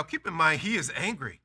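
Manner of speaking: ordinary delivery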